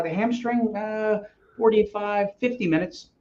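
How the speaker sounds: high, drawn-out hemming and hawing; mock pondering. Coach teases a novice about the time, mock-pondering. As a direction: draw out the "uh" as if calculating.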